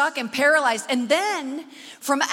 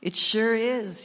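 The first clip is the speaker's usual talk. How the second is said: voice of God; 4 semitones lower, much slower than usual. Retold or imitated. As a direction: speak as God from deep below. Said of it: "God speaks to me".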